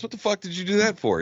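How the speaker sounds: high-pitched mock disbelief; acted incredulous question. Mexican passenger asking the Russian why, incredulous. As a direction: shoot the pitch up, playing baffled.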